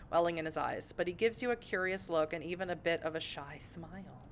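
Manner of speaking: ordinary delivery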